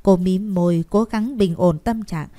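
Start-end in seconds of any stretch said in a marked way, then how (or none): none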